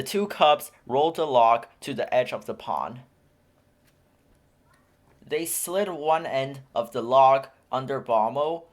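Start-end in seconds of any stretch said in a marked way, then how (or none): none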